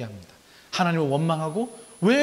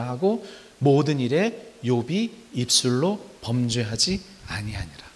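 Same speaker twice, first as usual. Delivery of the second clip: measured scripture reading, fading at the end; slow scripture reading; 3 semitones lower, 4 dB quieter, slower than usual. Shown as a demonstration. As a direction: read the verse slowly and solemnly. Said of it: "예, 일 장과 이 장에".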